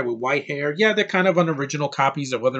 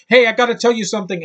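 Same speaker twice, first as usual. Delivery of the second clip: higher, louder put-on quote; mock movie character line; 8 semitones higher, 6 dB louder, faster than usual. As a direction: parody an eager character announcing news. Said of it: "they don't say something like".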